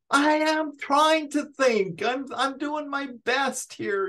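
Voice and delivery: put-on high, drawn-out exasperated kid's protest. Retorting to adults who say think, high-pitched mock exasperation. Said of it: "And I'd be like".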